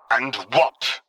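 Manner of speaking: scornful Vader; spat. A narrator's reading. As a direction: spit it out with scorn. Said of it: "Vader spat".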